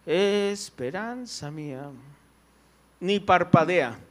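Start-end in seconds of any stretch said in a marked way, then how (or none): 0.1-1.9 lento, apagado, sin ninguna emoción; sin expresión. Shown as a demonstration. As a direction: dilo como quien no siente nada. (cantando sin expresar nada)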